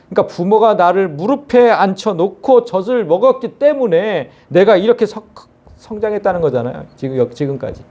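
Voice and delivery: usual speaking voice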